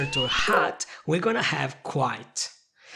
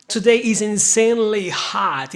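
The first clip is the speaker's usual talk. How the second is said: louder insanely hot, higher; emphatic exclaim; 4 semitones higher, 6 dB louder than usual. Shown as a demonstration. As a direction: stress the extreme heat. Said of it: "example of insanely".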